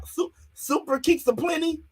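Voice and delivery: mock repeat.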